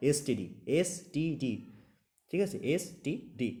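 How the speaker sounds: এসটিডি read slowly, letter by letter; slow, spell out এসটিডি letter by letter. Shown as a demonstration. Teacher making students hear এসটিডি letter by letter, slowly. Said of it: "এই লেটারগুলোকেই উচ্চারণ করতে হচ্ছে".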